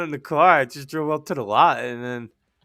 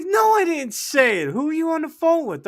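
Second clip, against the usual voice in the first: high-pitched, exasperated protest; mock indignant denial; 4 dB louder than usual. Upset Vinny denying he said it, mock indignant. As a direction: imitate a wronged coworker, voice shooting up.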